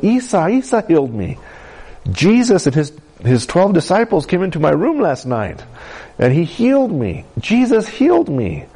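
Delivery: high-pitched, elated retelling of good news; bursting to share it, pitch climbing; excited. Retold or imitated. Healed girl tells the servant, excited and high. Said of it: "she just was so excited".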